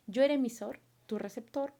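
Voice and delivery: ordinary talk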